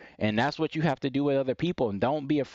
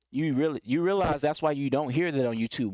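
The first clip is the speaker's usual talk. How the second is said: ordinary talk, nothing marked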